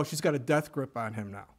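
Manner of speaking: normal talking voice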